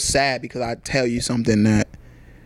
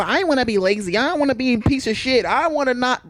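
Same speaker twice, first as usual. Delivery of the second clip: high, mocking voice of someone dodging effort; mock the lazy partner in a high whine; whiny lazy partner; 11 semitones higher than usual. Mocking a lazy partner to friends, high whiny voice.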